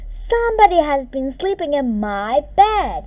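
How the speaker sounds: loud booming bear voice; booming Papa Bear. A narrator's reading. Papa Bear finds his rumpled bed, loud boom. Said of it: "In his great big voice, he boomed".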